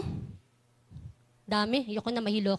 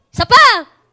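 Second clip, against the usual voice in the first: higher, louder rallying cry; loud shout; 5 semitones higher, 9 dB louder than usual. Real, not acted. Teacher shouting at the children for one more yell. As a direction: shout them on once more. Urging kids to shout again.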